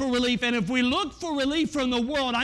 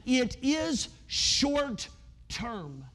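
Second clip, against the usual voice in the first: very slow, each word stretched; drawn out; much slower than usual. Real, not acted. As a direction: lean on it slowly to drive it home. Drawn-out emphasis on short-term.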